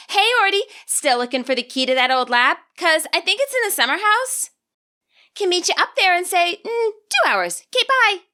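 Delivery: put-on sing-song it up high like a kid sister teasing her brother; teasing little sister. Sister texting Ortega about the summer house, high and teasing. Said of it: "two recent texts from his sister".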